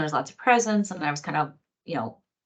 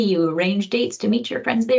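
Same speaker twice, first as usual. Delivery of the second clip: usual speaking voice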